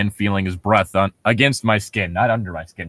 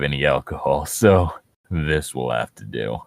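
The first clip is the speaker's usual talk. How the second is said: low villain voice; 9 semitones lower, 5 dB quieter than usual. Acted. Reading Jeff line low.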